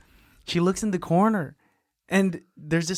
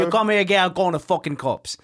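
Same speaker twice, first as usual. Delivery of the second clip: loud Cockney threat; mock Cockney; 4 semitones higher, 3 dB louder, faster than usual. The mom threatening to call cops, hammed-up Cockney. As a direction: scold like an angry mum in broad Cockney. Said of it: "I do a decent Cockney".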